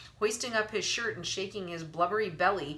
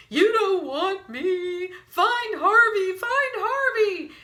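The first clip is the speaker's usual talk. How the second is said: much higher, louder, slow sobbing whine; tearful whine; 13 semitones higher, 9 dB louder, slower than usual. A narrator's reading. Wendell pleading not to be eaten, sobbing whine. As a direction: whine through snot and tears. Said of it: "Wendell whined, all snot and tears".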